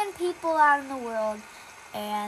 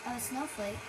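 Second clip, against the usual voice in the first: ordinary talk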